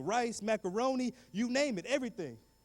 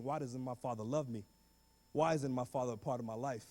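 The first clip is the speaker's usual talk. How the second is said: tearful; 6 semitones lower, 3 dB quieter than usual. Retold or imitated. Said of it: "crying and thinking".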